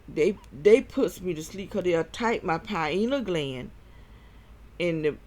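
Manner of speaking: normal talking voice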